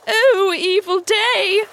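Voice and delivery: high, loud, drawn-out lament; crying out. A narrator's reading. Starkey lamenting the captain's news, crying out. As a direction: wail it high and slow. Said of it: "cried Starkey".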